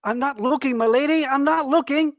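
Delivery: high, loud flustered protest; panicked squire. Acted. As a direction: blurt it out eyes shut in panic. Squire shielding eyes, panicked.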